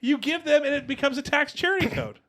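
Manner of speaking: high, loud, laughing excitement; excited. Real, not acted. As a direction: blurt out the brilliant idea. Excited idea, shouting and laughing.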